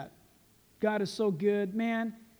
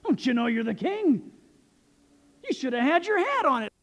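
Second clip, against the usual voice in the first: high, loud mock nagging; scolding wife; 6 semitones higher, 7 dB louder than usual. Preacher voices the wife rebuking the king, mock-indignant. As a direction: escalate into a comic scolding outburst. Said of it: "And she goes".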